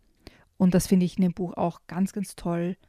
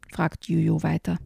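plain reading voice

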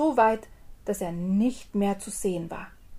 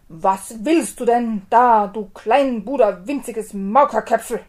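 booming, slow giant's roar; thundering giant; 10 dB louder than usual. Acted. Giant thunders at the tiny tailor, booming slow roar. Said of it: "Mit donnernder Stimme rief der Riese".

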